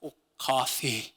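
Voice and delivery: coffee said low and flat like a Montana local, plain American kaw-fee; Montana accent. Retold or imitated. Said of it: "how do people say coffee in, in Montana?".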